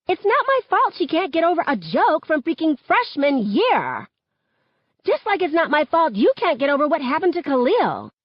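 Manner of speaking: high-pitched, defiant snapping back; heated retort. A narrator's reading. Schoolgirl refusing blame for her old joke, heated retort. Acted quarrel between schoolgirls.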